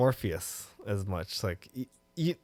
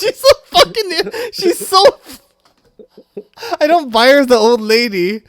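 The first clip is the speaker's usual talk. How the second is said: very high, loud, laughing outburst; helpless high-pitched exasperated laughter; laughing rant; 16 dB louder than usual. Real, not acted. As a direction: crack up while ranting, voice shooting up loud. Cracking up while ranting about actress.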